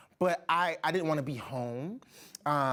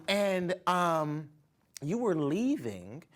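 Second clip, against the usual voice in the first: ordinary talk, nothing marked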